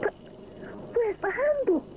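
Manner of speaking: high, startled question; mild surprise; exclaiming. Acted. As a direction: sound caught off guard. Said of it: "he exclaims".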